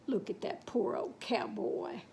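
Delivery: a soft, voiceless aside; pitying whisper. Real, not acted. Teller whispers pity for the battered cowboy. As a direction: hush it with pity for him.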